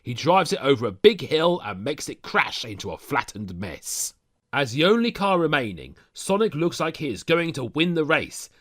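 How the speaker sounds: usual speaking voice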